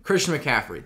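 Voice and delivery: usual speaking voice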